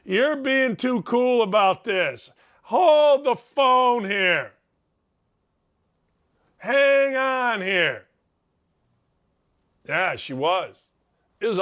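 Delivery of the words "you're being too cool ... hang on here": suspicious double take. Retold or imitated. Husband reacting to the wife being too calm, slow and drawn out. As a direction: stretch every word out in mock suspicion. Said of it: "makes you go".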